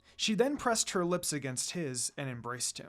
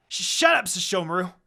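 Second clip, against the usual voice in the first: embarrassed shout; 7 dB louder than usual. A narrator's reading. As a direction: yell it right in his face. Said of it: "shouted at his face".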